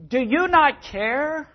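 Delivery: high, slow, hurt indignation; indignant complaint. Retold or imitated. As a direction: throw the question at the Lord, pitched high and slow. Martha confronting Jesus, acted.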